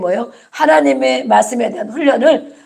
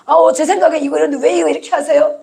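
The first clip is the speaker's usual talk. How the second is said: high-pitched mock complaint; objecting slave; 6 semitones higher than usual. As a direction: whine back at the master in a raised pitch. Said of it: "말할 수 있습니까?". (따지고 항의하듯 높은 목소리로)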